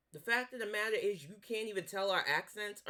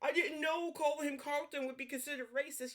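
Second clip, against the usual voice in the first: mock innocent Canadian; 7 semitones higher than usual. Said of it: "you wanted to act like".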